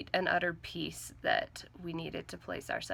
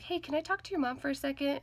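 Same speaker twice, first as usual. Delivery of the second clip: urgent; 8 semitones higher, faster than usual. Retold or imitated. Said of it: "it sounded a little bit urgent".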